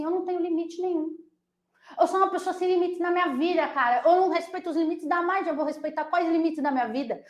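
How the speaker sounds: high, quick mock-rebel voice; defiant student. Student answering back to the margin, mock defiant. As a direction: play a pupil who refuses all limits. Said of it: "você fala assim".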